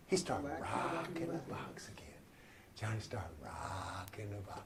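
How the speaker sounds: very soft, near-whispered preaching; hushed refrain. Acted. Preacher restarts Johnny's rocking from nothing, hushed. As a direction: sink right down and let it smoulder.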